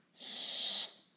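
voiceless, drawn-out shush; hushing. A narrator's reading. Lotje hushes Tommy so the parents won't hear, hushing. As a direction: shush a little brother. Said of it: "Papa en mama mogen niet horen".

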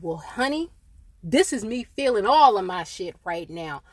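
usual speaking voice

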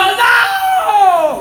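high shouted no; sharp high mock yell; retold shout. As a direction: yell across the altar. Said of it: "y usted yo gritando".

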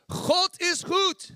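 "God is goed" very high, loud and slow; fervent Dutch shout. Shown as a demonstration. Preacher urging Jessica up, a loud, high, slow shout. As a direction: belt "God is goed" like a revival. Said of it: "You should be on your feet like".

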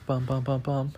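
soft bum bum drum roll fading to a whisper; mouth drum roll. Shown as a demonstration. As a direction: build suspense with a hushed vocal drumroll. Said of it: "drum roll, please".

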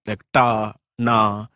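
"ta" and "na" with the tongue tip curled back striking the palate; retroflex consonants. Shown as a demonstration. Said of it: "sounds that you have to make by curling your tongue and striking your palate".

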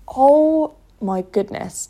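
slow, awestruck gasp of words; awed. Retold or imitated. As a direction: let it land slowly in wonder. Reacting to hearing the talk.

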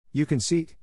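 high-pitched, indignant sarcasm; sarcastic exclamation. A narrator's reading. Shocked girl rebuking a cocky boy, sarcastic. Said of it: "with frightful sarcasm".

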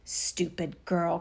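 low, drawn-out witch's scorn; witch's scolding cry. A narrator's reading. Witch to Gretel at the oven, low scolding cry. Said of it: "cried the witch".

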